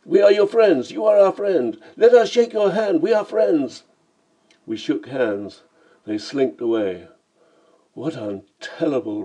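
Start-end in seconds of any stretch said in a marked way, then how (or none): 0.0-3.8 very high, loud panicked begging; shrieked, desperate appeasement; screamed plea. A narrator's reading. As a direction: plead frantically for mercy. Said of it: "they screamed".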